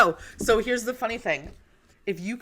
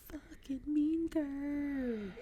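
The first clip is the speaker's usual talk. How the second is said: movie quote; 7 semitones higher, 12 dB quieter, much slower than usual. Retold or imitated. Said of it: "I love that movie".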